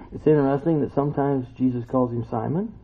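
usual speaking voice

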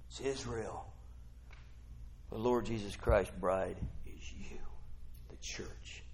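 very soft, low, ending whispered; hushed reveal. Real, not acted. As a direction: sink to a confiding hush.

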